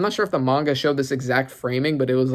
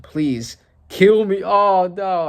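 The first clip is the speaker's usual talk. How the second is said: slow, drawn-out tearful plea, a bit higher; mock crying plea; 6 semitones higher, 4 dB louder, much slower than usual. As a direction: beg to be killed, acted tearfully. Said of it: "he's crying".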